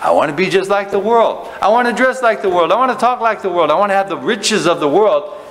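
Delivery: high, loud, whiny mock of a half-hearted believer. Preacher mocks believers who call worldliness a sacrifice, in a high whiny sneer. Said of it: "We think".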